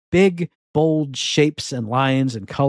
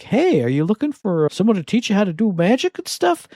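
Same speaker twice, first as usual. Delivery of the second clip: higher, chirpy sales pitch from a witch coven; 6 semitones higher than usual. Retold or imitated. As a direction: read it like a cheery recruiting ad. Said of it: "You answer an ad for like".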